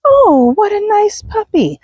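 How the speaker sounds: slow, high mock cooing at a puppy; gushing passer-by. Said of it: "as soon as they say something".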